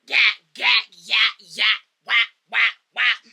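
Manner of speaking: loud, rhythmic punch sound effects; imitated punches. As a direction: hammer out each blow as a loud noise. Acting out imagined beating blows.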